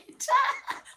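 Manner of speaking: a high, bright burst of real laughter. Real, not acted. Laughing while admitting she talks to herself. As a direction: laugh out loud at yourself.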